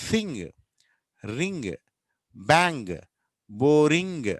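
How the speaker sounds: థింగ్, రింగ్, బ్యాంగ్ each ending in a held nasal; spaced word list, humming the end of బోరింగ్; model final ng. Shown as a demonstration. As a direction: let the ng ring on in రింగ్ and బ్యాంగ్.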